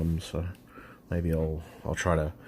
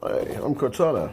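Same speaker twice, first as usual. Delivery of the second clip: mock Cortana voice; 8 semitones higher, 5 dB louder than usual. Mocking the Cortana setup voice.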